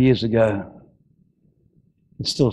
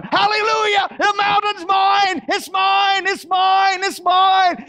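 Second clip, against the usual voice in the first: put-on old man crying out in triumph; exultant shout; slower than usual. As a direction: shout it like you've already won. Old Caleb running down, exulting.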